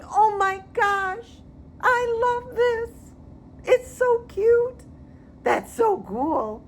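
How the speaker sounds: high, slow, swooning delight; gushing girly voice. Pretend. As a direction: coo over the house like a smitten buyer. Voicing the trendy girl buyer.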